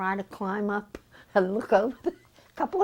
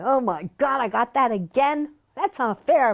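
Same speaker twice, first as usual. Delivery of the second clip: loud, high mock protest; kids yelling complaints; 5 semitones higher, 5 dB louder than usual. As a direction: imitate indignant kids objecting to their chores. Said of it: "hear all the kids yelling".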